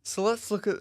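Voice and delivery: words stretched through a yawn. Real, not acted. Streamer introducing the group table while yawning. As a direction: talk mid-yawn. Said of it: "excuse me, I'm yawning".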